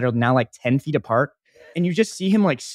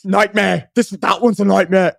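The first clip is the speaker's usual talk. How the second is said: high, agitated attempt at an Australian accent; mock Australian freak-out; Jacob Elordi; 5 semitones higher, 5 dB louder than usual. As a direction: yelp it like an Australian in pain. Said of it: "an Australian accent, but he's like".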